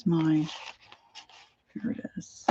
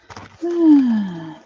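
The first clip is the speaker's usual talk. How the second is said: long, loud falling sigh; 5 semitones higher, 7 dB louder than usual. Real, not acted. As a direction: let out a big high sigh, sliding down. Loud sigh while searching through stamps.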